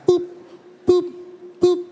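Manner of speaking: high imitated checkout boops; short, spaced-out boops mimicking a scanner; scanner beeps. Cashier scanning items at the grocery store, high imitated beeps.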